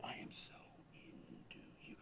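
barely audible, intimate whisper; whispered come-on. Retold or imitated. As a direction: whisper it like a dazed, drugged-up confession. Retold come-on while on ecstasy.

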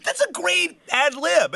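high, drawn-out laughing praise; helpless high-pitched amusement. Real, not acted. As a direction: crack up while praising it. Cracked up by the ad lib.

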